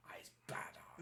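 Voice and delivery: awed hushed whisper. Real, not acted. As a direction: breathe it out in awe.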